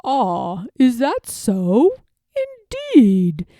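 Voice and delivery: smooth, slow, courteous fox voice; a fox being oily-polite to a duck; sly fox gentleman. Acted. Fox gentleman greeting Jemima, smooth and sly.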